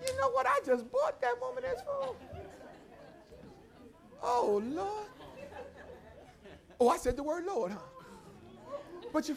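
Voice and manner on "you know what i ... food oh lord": high, soft puzzled aside; a grumpy man startled by his own kindness; bewildered neighbor. Retold or imitated. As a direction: muse in disbelief at what he did.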